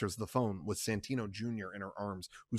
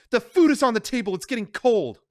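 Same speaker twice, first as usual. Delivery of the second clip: much higher, louder, fast; angry insistence; 11 semitones higher, 8 dB louder than usual. Acted. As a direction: push the point hard. Connie insists.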